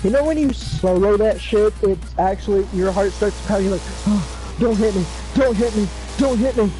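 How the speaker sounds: ordinary delivery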